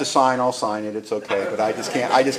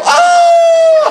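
very high, loud mock shriek; little-girl scream; 11 dB louder than usual. Tough guy charged by dogs, little-girl scream. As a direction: scream like a small child. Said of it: "screaming like a six-year-old girl".

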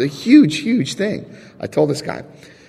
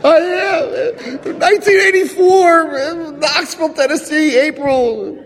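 much higher, laughing outburst; blurted confession; slower than usual. Retold or imitated. Confessing to his sponsor, a high giddy blurt.

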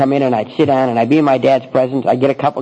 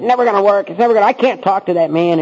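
higher, insistent, fed-up complaint; exasperated protest; 8 semitones higher than usual. Retold or imitated. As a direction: push back stubbornly. Protesting to sponsor again.

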